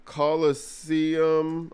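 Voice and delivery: drawn-out, very slow Coliseum; sounding it out. Shown as a demonstration. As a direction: stretch Coliseum out slowly. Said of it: "I don't know if I spelled it right".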